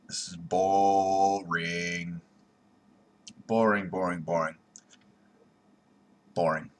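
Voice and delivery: ordinary talk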